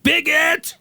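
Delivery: sudden high shouted "Bigot!"; yelled insult. Retold or imitated. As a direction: bark it out the window at the neighbour. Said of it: "leaned out the window and was like".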